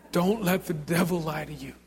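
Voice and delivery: high, loud altar call; rousing altar call over music; fervent preaching. Real, not acted. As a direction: preach with full fervor. Preaching altar call at full force.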